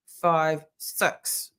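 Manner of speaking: "six" as "sux", imitated New Zealand vowel; New Zealand accent. Showing how New Zealanders count, New Zealand accent. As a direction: say "six" like "sux", mouth barely open. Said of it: "they go".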